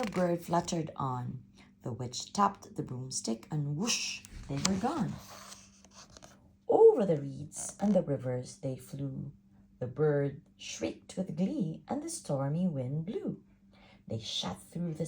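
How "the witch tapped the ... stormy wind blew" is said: ordinary delivery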